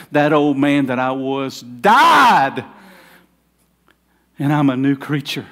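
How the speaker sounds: loud, slow proclamation; retelling a prisoner's testimony, booming; rising to a shout. Retold or imitated. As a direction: declare it slowly at full voice. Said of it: "and he says".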